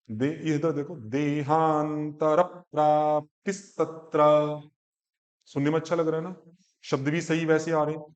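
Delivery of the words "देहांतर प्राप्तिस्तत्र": देहांतर प्राप्तिस्तत्र slow and even, each cluster clear; slow model recitation. Shown as a demonstration. Teacher modelling the line देहांतर प्राप्तिस्तत्र slowly. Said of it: "मैं बोल रहा हूँ".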